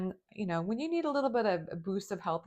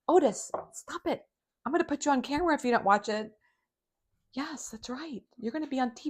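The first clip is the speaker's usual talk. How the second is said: scolding the dog; 6 semitones higher, faster than usual. Real, not acted.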